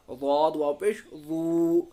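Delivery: slow "दू" held out long; stretched long vowel. Shown as a demonstration. Teacher shows the madd, "दू" stretched. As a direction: draw out "वाद" and "दू". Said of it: "खींचते हैं इसको".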